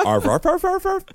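high-pitched mock dog barks; dog bark imitation. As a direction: yap like a dog in a quick run.

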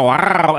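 loud, full-throated cat growl; strong, open showman's growl; growl as before. Shown as a demonstration. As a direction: let out a big rising-then-falling "rowr". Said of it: "I used to go".

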